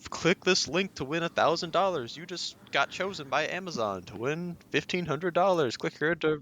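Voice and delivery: high-pitched mock prize announcement; phishing email pitch. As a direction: parody an over-eager scam promotion. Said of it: "the phishing emails too".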